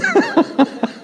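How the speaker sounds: a short high burst of laughter. Real, not acted. Pastor amused by his own notes, high laugh. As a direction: let a real laugh escape, high.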